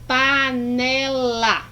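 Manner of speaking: "panela" stretched out in three separate syllables. Shown as a demonstration. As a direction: say "panela" slowly, one syllable at a time. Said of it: "na pronúncia das palavras".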